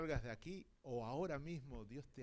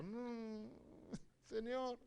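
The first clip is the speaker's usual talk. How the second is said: mock whiny protest; 7 semitones higher, much slower than usual. Protesting God's order to sweep.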